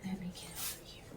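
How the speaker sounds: "Let me get over here" whispered fast and very soft; quick whisper. Real, not acted. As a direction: breathe it out quickly under the breath. Same words whispered quickly.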